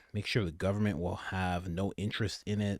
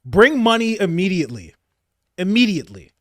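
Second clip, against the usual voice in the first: loud, high mock Trump demand; imitating Trump; 10 semitones higher, 11 dB louder than usual. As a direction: bark the order like a hammed-up Trump. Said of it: "Trump is like".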